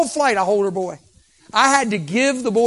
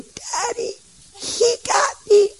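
put-on very high, slow child's voice; little girl squeal; slower than usual. Toddler tells her dad the stranger caught her foot, high and squealing. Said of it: "I heard her go".

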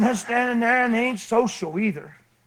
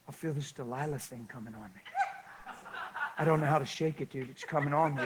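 low, soft, troubled admission to a buddy; low-voiced pretend confession; hushed mock confession; 4 semitones lower, 10 dB quieter than usual. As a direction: confide it under your breath, playing troubled. Mock confession to his friend.

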